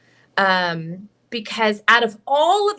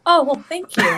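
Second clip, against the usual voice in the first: higher, louder polite thanks; gracious thank you; 5 semitones higher, 5 dB louder than usual. Retold or imitated. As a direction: play it sweetly grateful. Accepting grace, acted reply.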